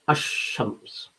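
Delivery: "ash-shams" running straight into a long sh; straight to sheen. Shown as a demonstration. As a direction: say "ash-shams" softly, with no L before the sh. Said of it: "You go straight to sheen".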